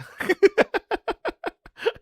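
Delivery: high-pitched burst of laughter. Real, not acted. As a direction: laugh out in disbelief. Laughing at seven shots.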